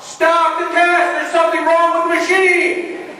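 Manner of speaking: high, loud, slow shout for help; panicked yell. Retold or imitated. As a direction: yell out from inside the tube to stop it. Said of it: "I yell out".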